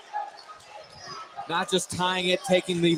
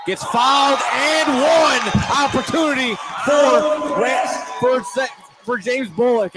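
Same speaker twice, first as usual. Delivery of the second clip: loud, high, slowed play-by-play yell; excited shout; 7 semitones higher, 4 dB louder than usual. Real, not acted. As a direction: belt out the and-one call like the gym just erupted. Calling a foul at game's end.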